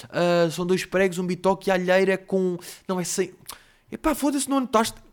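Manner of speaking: high-pitched, building exasperation; harried waiter losing track of orders; flustered waiter. Acted. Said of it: "no lodo, porque não estava a conseguir".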